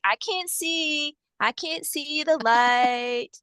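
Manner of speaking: slow, high, teasing spirit voice; mocking entity. Entity wearing sunglasses refusing the light, slow mocking voice. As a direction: drawl it out like a smug being pretending to be blind. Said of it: "It was like".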